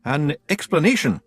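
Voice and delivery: high, uneasy Scots voice; stalling nervously; uneasy Rob. A narrator's reading.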